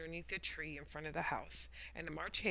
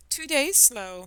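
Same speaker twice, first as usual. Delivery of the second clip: sighing; 8 semitones higher, 8 dB louder, slower than usual. Acted. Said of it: "sighed the Hatter".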